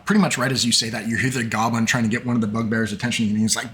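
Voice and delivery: normal talking voice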